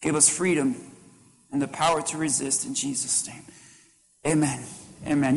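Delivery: soft, hushed prayer voice; murmured prayer to close; closing prayer. Acted.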